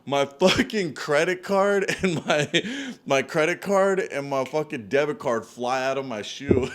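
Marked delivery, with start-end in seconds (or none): none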